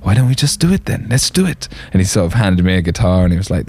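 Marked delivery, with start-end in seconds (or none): 0.0-1.7 light imitation of a Swedish accent, pitched a bit higher; a hint of a Swedish accent, raised voice. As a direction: lightly mimic a friendly Swedish producer's accent. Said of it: "in his lovely Swedish accent".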